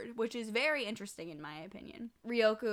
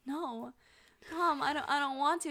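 put-on higher-pitched, reluctant kid voice; small retold plea, pitched up; pleading child; 6 semitones higher than usual. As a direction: lightly imitate a child begging mom. Voicing child refusing to leave mother.

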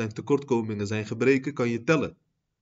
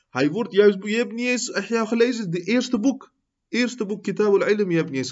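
preach; 9 semitones higher, 7 dB louder than usual. Acted.

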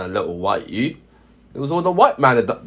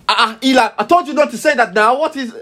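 put-on much higher, louder African-accented telling-off; vexed African mum; 13 semitones higher, 4 dB louder than usual. Mum catches her son's mistake, vexed African accent. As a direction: let the African accent burst out, high and vexed. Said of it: "that's when the African comes out, 'cause she's vexed now".

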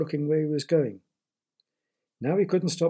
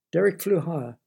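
ordinary delivery, nothing marked

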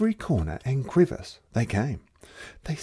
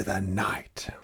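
whisper; 4 semitones lower, 7 dB quieter than usual. A narrator's reading. Cat sneaking out at night.

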